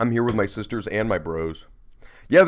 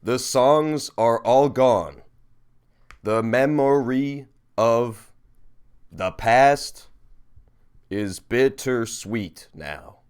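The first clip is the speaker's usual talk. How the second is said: slow, solemn haiku reading; haiku recital; much slower than usual. Acted. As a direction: recite it slowly, like a poem. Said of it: "I'm gonna tell you your haiku".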